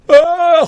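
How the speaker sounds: high, loud yelp; sudden yell. Acted. Someone dropping into the pool after another, sudden yell. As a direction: cry out sharply at a high pitch.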